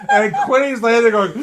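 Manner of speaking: usual speaking voice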